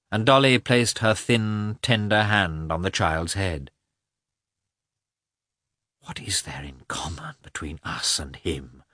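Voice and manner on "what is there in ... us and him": soft, low musing to himself; inward thought. A narrator's reading. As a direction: think it aloud, hushed and lowered. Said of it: "thought Lievin".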